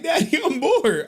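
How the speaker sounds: high-pitched mimicked kid complaint; daughter's voice. Bored daughter complaining to Dad, high child impression. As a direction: imitate a bored little girl, high. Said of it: "She like".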